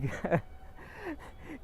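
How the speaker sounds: high, breathy hermit's chuckle; a wise old man's amused laugh; gentle high laugh at the king. Acted. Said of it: "And the hermit started laughing".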